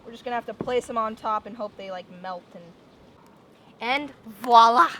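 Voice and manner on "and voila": drawn-out voila with a rise; excited reveal. Real, not acted. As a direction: present it proudly. Presenting finished cake.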